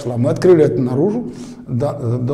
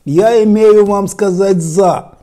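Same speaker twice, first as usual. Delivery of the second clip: slow Odessa-accented Russian, high and loud; 7 semitones higher, 8 dB louder, much slower than usual. Shown as a demonstration. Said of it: "Есть одесский акцент".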